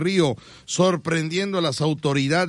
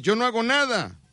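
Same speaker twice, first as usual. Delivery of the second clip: higher put-on voice; lightly voiced idler; mock lazy answer; 9 semitones higher than usual. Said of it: "tú me dices".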